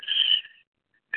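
whispered shh; hush. Acted. Young woman hushing the poet mid-kiss, whispered. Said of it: "She kissed me and said".

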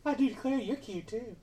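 Southern belle voice. Acted. Plays a flirting belle.

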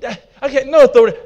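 ordinary delivery

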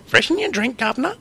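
very high cartoon Cockney accent; terrible English accent. Co-host retelling a Simpsons casino scene, hammed-up English accent. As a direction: put on a loud, squeaky English accent. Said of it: "That's pretty terrible accent".

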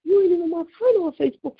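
firing back at an unfair accusation; retold indignation. Retelling how she answered Carol, fast and indignant. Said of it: "I was mad".

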